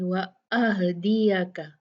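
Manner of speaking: अहदिया with हा pronounced clearly, not stretched; slow recitation, clear हा; clear haa. Shown as a demonstration. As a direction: sound हा distinctly, don't drag it. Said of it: "हा जो है उसको अच्छे से आपने ... जाहिर करना है".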